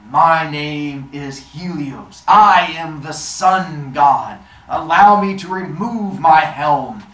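slow, loud, booming proclamation; deep rumbly god. Acted. Sun god addressing his dazzled son, slow rumbly voice. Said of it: "this deep, rumbly voice starts to speak".

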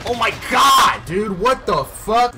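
loud, high, slow outburst; raging gamer. Real, not acted. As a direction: explode in disbelief. Raging at the game.

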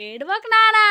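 high, loud coaxing. Real, not acted. Second child starts crying too. (గట్టిగా, పై స్వరంలో ఓదార్పు)

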